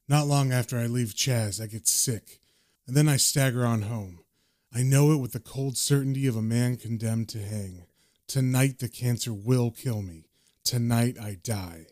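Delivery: a hint of a doomed man's inner monologue; John's narration. John narrates his last night with cancer, low and subdued. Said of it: "and the narration says".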